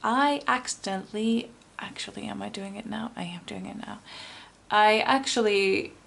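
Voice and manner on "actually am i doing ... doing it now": unvoiced whisper to oneself; whispered aside. Real, not acted. Reader checks whether the recording is running, whispered aside. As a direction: whisper a quick check under your breath.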